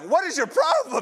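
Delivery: high, loud mock outrage; indignant outburst. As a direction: snap it out high as an acted protest. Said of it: "No, you'd be like".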